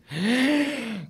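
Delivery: high-pitched gasp of shock; re-enacted shocked gasp. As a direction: gasp high, acting out sudden panic. Shock at learning of lead role.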